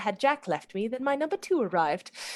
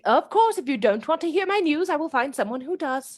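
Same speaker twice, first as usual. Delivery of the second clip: much higher, louder mock offense; mock huff; 6 semitones higher, 4 dB louder than usual. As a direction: pretend to be hurt and storm off.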